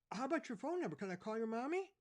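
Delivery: put-on officer asking a lost boy; policeman. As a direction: coax a lost kid. Policeman voiced in story.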